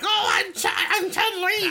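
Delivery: put-on high, shouted old lady voice. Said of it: "this old lady".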